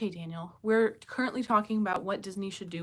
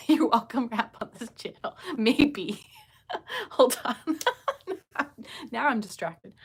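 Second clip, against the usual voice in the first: laughing; 6 semitones higher, 8 dB louder than usual. Real, not acted. Distracted and laughing at chat.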